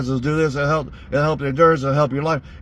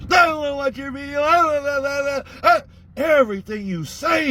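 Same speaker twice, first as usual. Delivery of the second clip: mock whiny voice; 10 semitones higher than usual. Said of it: "mocking Don again".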